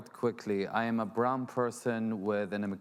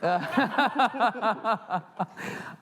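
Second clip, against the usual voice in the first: high loud laugh; 12 semitones higher, 5 dB louder than usual. Real, not acted. Cracking up at the panel joke, high loud laugh.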